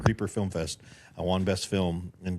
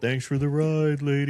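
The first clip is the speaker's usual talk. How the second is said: high, loud, drawn-out movie quote; hammed-up catchphrase, every word stretched; movie hitchhiker; 8 semitones higher, 5 dB louder, slower than usual. Podcast guest quoting a horror film to the host, hammed-up hitchhiker.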